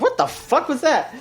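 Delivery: high-pitched, baffled disbelief; squawked mock outrage, voice way up; mock incredulous outburst. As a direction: imitate friends appalled at a bad accent. Acts out friends' baffled reaction.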